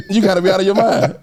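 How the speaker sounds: laughing through the words; cracking up at yourself. Real, not acted. Laughing at his own story.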